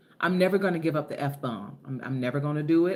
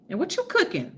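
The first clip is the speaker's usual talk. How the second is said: excited question; 12 semitones higher than usual. Real, not acted.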